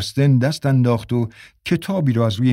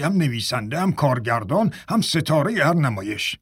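raised-pitch telling-off, stretched insults; scolding; 5 semitones higher than usual. A narrator's reading. Major dressing down Dalen before the cast, scolding. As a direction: lay out each accusation emphatically.

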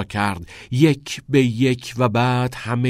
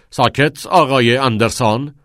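loud, stern command for silence; angry order; 3 semitones higher, 8 dB louder than usual. A narrator's reading. Nolan rises angrily to silence Todd, angry order. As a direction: shut the pupil up with force. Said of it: "نولان با عصبانیت از جا بلند شد و دستور داد".

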